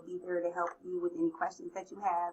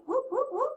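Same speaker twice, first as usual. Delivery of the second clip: whoop cheer. Acted. Mock celebration chant.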